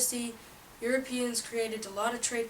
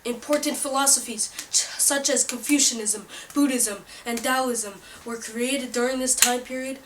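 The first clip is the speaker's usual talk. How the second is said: loud, pushy infomercial pitch; hard sell ad; 3 semitones higher, 9 dB louder than usual. Acted. Pitch continues loudly.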